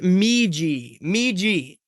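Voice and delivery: "Meiji" said slowly as MEE-jee; careful name pronunciation. Shown as a demonstration. Streamer corrects how a viewer's name is said, careful "Meiji" as MEE-jee. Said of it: "it's pronounced".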